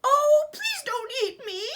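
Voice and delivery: high frightened wail; pleading cry. Acted. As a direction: beg for your life. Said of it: "cried Little Billy Goat Gruff".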